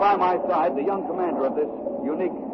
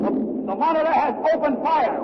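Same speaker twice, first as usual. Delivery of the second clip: higher, urgent reporting as the cannon fire; excited battle report; 8 semitones higher than usual. Acted. Reporter inside the turret telling listeners, excited and urgent. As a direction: rush it out in the thrill of the first shot.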